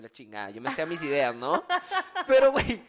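ordinary talk, nothing marked

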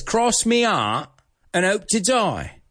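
high, put-on British accent; drawn-out British, dropping the h's. Teacher quoting the film's guy, high British accent. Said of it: "I'm trying to be British here".

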